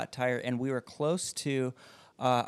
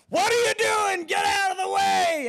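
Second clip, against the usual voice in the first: very high, loud dad hollering; angry yell; 6 dB louder than usual. Retold or imitated. Friend's dad to the girl who stopped to help, angry yell. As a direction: imitate a frantic dad yelling at someone in the way. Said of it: "you got yelled at".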